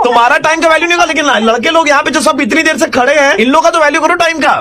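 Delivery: high, loud, fast scolding; pretend angry rant. Prank caller loudly ranting that the boys have waited so long, high, loud and fast. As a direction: berate her about wasting the boys' time.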